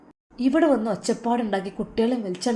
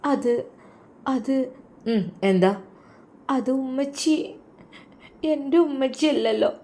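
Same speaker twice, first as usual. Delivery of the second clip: put-on little girl starting to cry, soft and higher; tearful child; 4 semitones higher, 4 dB quieter than usual. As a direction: hold back tears as the small daughter. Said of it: "മോളെന്താ കരയണത്?". (കരച്ചിലടക്കുന്ന കുഞ്ഞിന്റെ ശബ്ദം)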